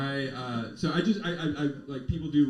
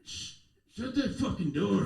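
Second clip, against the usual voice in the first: higher-pitched roused addict; drug-stupor impersonation; 6 semitones higher than usual. Acted. Man coming out of a drug stupor shushes realtor, groggy. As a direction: do a mock stupefied shush. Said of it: "do a very offensive impersonation".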